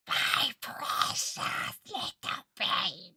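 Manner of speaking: slow whispery witch croon; evil witch voice. Retold or imitated. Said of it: "sound like an evil witch".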